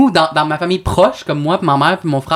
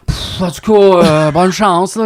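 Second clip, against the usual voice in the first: 1 dismissive cousin; 2 semitones higher, slower than usual. Pretend. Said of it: "j'aurais vraiment dit comme".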